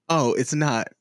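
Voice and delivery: ordinary talk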